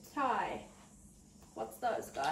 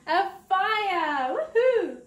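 excited cheer; 6 semitones higher, 4 dB louder, much slower than usual. Real, not acted. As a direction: cheer them on, bright and loud. Child guesses fire correctly.

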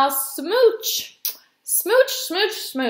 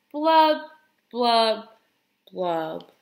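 three blubs stepping down in pitch; glum fish blubs; 3 dB louder, much slower than usual. Acted. Pout-pout fish ending his dreary refrain, sinking glum blubs.

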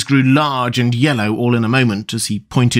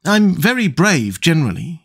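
ordinary delivery

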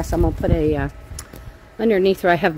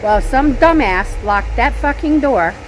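worked-up rant, higher and louder; pissed off; 6 semitones higher, 6 dB louder than usual. Real, not acted. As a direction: vent real anger, pushing pitch and volume up. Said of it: "I'm pissed".

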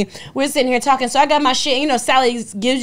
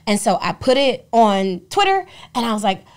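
ordinary talk

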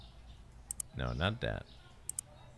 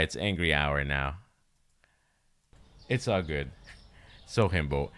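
normal talking voice

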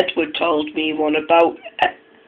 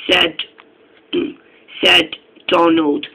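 usual speaking voice

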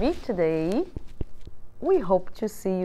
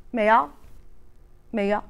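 peacock meow. Acted. Peacock's cry in story.

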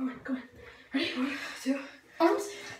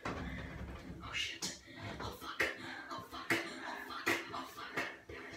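strained whisper; 20 dB quieter than usual. Real, not acted. Sinking into freezing water.

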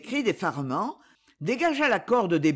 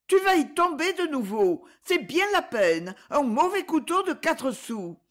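exasperated; 8 semitones higher than usual. A narrator's reading. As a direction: scold him for going back for a knife. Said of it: "s'écria-t-elle exaspérée".

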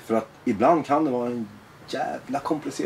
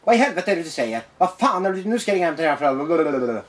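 higher-pitched outraged scolding; mock hitting the roof; 3 semitones higher than usual. Imitating a school counselor scolding a pupil, mock fury. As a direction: play a counselor blowing up, hammed-up. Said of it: "hon går i taket".

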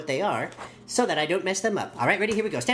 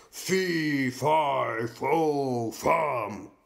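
low booming giant chant; 3 semitones lower, much slower than usual. Shown as a demonstration. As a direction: stomp and boom like a giant. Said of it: "really play with that giant voice".

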